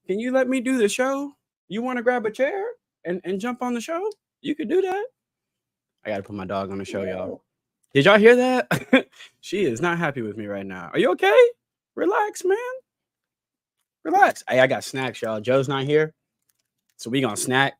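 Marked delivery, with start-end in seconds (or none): 0.1-5.1 high-pitched pet-talk voice; talking to the dog. Real, not acted. Host asks the dog to let him do the show, high coaxing pet talk. 10.9-14.4 very high, louder pet-talk voice; talking to the dog. Real, not acted. Host tells the unhappy dog to relax, high pet-talk voice.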